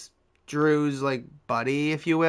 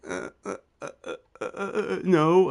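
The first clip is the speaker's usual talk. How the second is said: halting grunted "uh"s climbing to a high "no"; mocking imitation of sputtering; imitated stammering noises; 5 semitones higher than usual. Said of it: "he literally said it like that".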